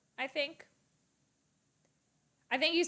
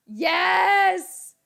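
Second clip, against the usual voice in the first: loud, high victory shout; shouted yes; 8 semitones higher, 11 dB louder than usual. Real, not acted. Streamer shouting as the Bills score. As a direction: cheer with everything you have. Bills make a big play.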